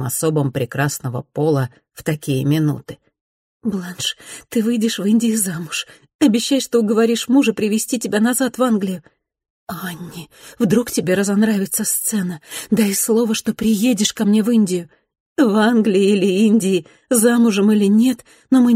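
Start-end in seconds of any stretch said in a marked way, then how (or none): 3.6-9.0 put-on young girl pleading. Tearful farewell of two girls. 9.7-14.9 put-on young girl pleading. Tearful farewell reply.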